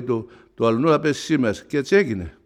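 ordinary talk

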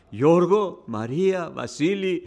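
loud ringing sermon cry; loud preaching. Acted. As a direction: call the names out from the pulpit. Calls out in sermon.

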